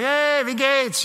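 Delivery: high, slow, bright hello in German; cheerful German greeting. Retold or imitated. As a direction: greet a stranger gladly in German. Greeting German speakers, quoted self.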